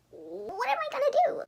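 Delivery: very high, desperate rising cry; distressed Jason. Acted. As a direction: squeak it out like a cornered boy.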